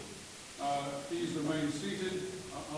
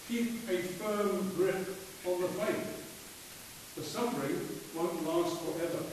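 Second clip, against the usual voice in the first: scripture reading; 4 semitones higher than usual. Acted. Reads scripture at funeral.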